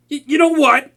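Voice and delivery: high rattled outburst; flustered. Acted. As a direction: blurt it to escape the topic. Flustered, changing subject.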